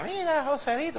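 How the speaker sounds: high, soft sing-song call; calling to a boy. Retold or imitated. Neighbor calls the boy Joselito with candy, high and coaxing. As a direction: lure a kid over sweetly. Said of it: "Mingui decía".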